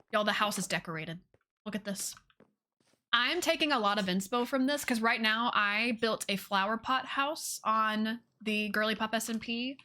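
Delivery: ordinary delivery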